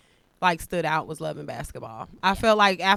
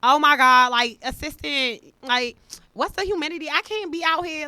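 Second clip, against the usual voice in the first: higher-pitched, hammed-up fretting heroine; mocked inner-girl voice; 9 semitones higher, 5 dB louder than usual. Imitating the heroine fretting to her assistant about humidity, mocked inner voice.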